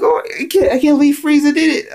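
very high, stunned cry; stunned fan voice; shocked disbelief. Retold or imitated. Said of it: "I was like".

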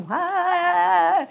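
high loud cheer; mock party cry; excited shout. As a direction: whoop like a party. Mock celebration exclamation.

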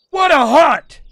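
high, loud exclamation; triumphant. Real, not acted. Hunter sums up the day, triumphant. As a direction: proclaim it in triumph. Celebrates the hunt.

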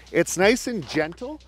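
normal talking voice

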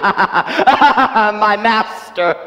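slow, loud cackling laugh; a slow, loud manic laugh; mad laughter. Acted. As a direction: laugh madly over the words. Renfield laughs about his master.

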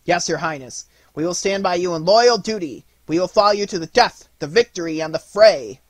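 eager cry. A narrator's reading. Toy soldiers pledging to follow Nutcracker into battle, eager loud cry. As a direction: answer eagerly, building in volume. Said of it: "cried in eager accents".

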